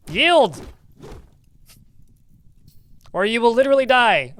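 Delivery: loud, high demand for surrender; commanding yell. Acted. Player ordering an enemy to yield or die, loud command. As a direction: bark the ultimatum at a foe.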